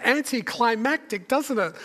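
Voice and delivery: ordinary talk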